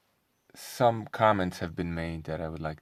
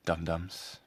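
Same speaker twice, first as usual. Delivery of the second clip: ordinary delivery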